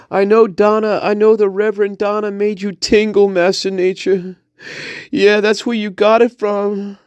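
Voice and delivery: high-pitched character voice; gushing spirit guide. Pretend. Spirit guide tells Master Nature about Donna's tingle, high gushing voice. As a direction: rave in a steady high comic voice.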